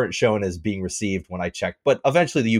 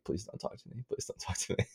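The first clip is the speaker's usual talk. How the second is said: pleading under the breath in a whisper; barely audible, whispered begging; whispered plea; 18 dB quieter than usual. Retold or imitated. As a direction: whisper it like a silent wish. Silently pleading not to be approached.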